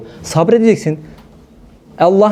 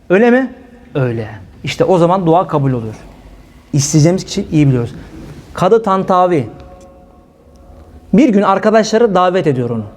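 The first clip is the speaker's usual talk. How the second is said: normal talking voice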